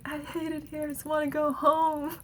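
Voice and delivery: mild mock child wail; retold child scream. Recalling screaming at parents as a child, mild mock wail. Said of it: "I used to scream, like".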